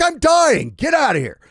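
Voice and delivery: put-on high, loud old man shooing him out; hammed-up angry kick-out; cranky grandpa yell. Grandpa voiced yelling.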